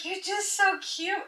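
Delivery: put-on high, drawn-out baby-talk coo; cutesy gushing. Woman recounts doting on her painted monkey, cutesy gushing. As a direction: coo adoringly at something tiny.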